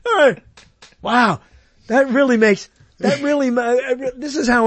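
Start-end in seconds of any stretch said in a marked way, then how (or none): 0.0-1.4 mock amazement. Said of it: "they go".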